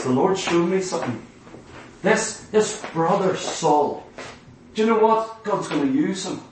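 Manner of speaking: a quiet Ananias sharing what the Lord showed him; hushed confiding. Acted. Ananias quietly telling of vision.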